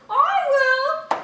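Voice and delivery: high, loud, drawn-out offer; eager cat. A narrator's reading. Cat offering to eat the bread, high and loud. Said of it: "said the cat".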